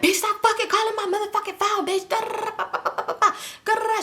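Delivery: high-pitched irate mimicry tumbling into babble; mock furious rant. Shisha answering repeated calls, mock furious high rant. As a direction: rant it high like someone fed up.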